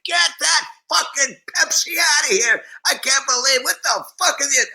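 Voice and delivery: high-pitched imitation of an outraged scream; retold screaming tirade. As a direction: rant at the top of your range, mimicking a furious star. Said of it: "[name] starts screaming at the top of his".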